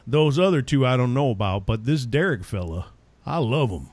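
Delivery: a hint of a Texas listener; Texan accent. Texas radio fans praising Derek, in a light Texan accent. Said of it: "Texans who are going".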